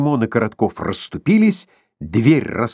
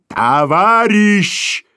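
slow, drawn-out and higher, in a voice unlike the narrator's own; furious clang; 10 semitones higher, 4 dB louder, much slower than usual. A narrator's reading. Rushing boss brushes off the clerk, a furious drawn-out call. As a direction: ring the word out like a hard bell. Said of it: "звякнул бешено".

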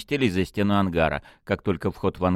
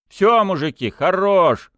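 loud, high shout; 12 semitones higher, 7 dB louder, slower than usual. A narrator's reading. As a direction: break up the fight with a shout. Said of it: "выкрикнул он".

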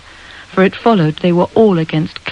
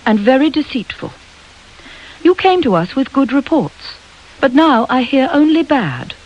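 higher, sharper headmistress voice, lecturing a pupil; stern headmistress scolding; 5 semitones higher than usual. Acted. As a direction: tell off a lazy schoolgirl from above.

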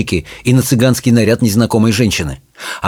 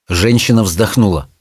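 plain reading voice

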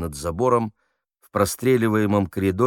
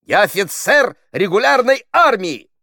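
high, loud indignant declaration; officer's offended shout; raised voice; 14 semitones higher, 8 dB louder than usual. A narrator's reading. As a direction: proclaim your rank in outrage. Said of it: "повысил голос Хуриев".

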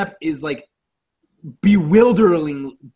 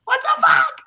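very high squeal of disbelief; incredulous. Real, not acted. Reacting to Jacob Tremblay as the kid, high-pitched incredulity. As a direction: squeal it in stunned amazement. Disbelief at surprise child casting.

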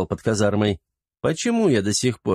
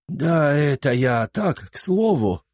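put-on sheepish old servant; 5 semitones higher, slower than usual. A narrator's reading. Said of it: "виновато глядя на жену".

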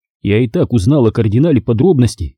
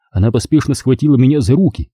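plain reading voice

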